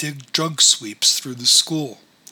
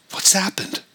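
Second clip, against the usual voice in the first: tense; 7 semitones higher than usual. Acted. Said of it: "Her voice showed tension".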